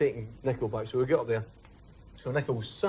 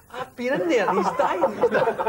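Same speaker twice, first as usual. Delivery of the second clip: laughing retelling; 11 semitones higher, 4 dB louder than usual. Retold or imitated. Nico insists Kenny is dying.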